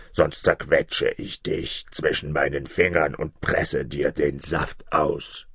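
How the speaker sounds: low giant voice, slowing at the end; threatening giant. A narrator's reading. Giant threatens to crush the shepherd, low and menacing. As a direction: menace him low, drawing out the last words. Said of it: "drohte der Hühne".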